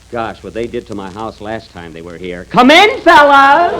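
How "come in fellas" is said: a loud, much higher welcome; hearty call. Acted. Jack greeting the orchestra boys he dreads, loud hearty call. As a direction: call them in with forced cheer.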